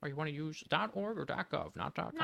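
a hint of a lecturing teacher; imitating a teacher. Mimicking the elementary school teacher's advice. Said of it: "my elementary school teacher taught us".

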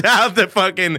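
loud, high laughing. Real, not acted. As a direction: burst out laughing mid-sentence. Laughing outburst.

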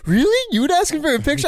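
high-pitched mock disbelief; incredulous. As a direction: imitate a friend astonished, voice shooting way up. Retold incredulous reaction to friend.

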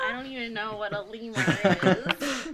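loud protest breaking into laughter; hopeless lie through giggles; laughing bluff. Acted. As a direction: insist loudly while cracking up.

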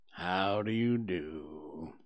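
low, slow, mournful donkey voice; very gloomy. Acted. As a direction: greet him as if nothing could cheer you. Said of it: "in a very gloomy manner".

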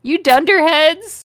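loud, high yell; scream. Acted. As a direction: yell it at the class. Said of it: "screamed Dumbledore".

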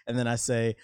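normal talking voice

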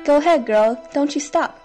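usual speaking voice

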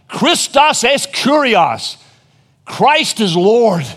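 loud, slow, drawn-out proclamation; yelling out. Acted. Preacher voicing martyrs declaring their faith as they die, yelling out. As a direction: shout it slowly as a martyr's last cry. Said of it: "died yelling out".

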